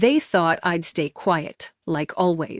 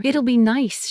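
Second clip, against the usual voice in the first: bright, higher cheerful invite; 6 semitones higher, 3 dB louder than usual. Acted. Mom inviting her daughter to dinner, falsely cheerful. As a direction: sound breezy, like nothing is wrong. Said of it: "she said, all cheerful".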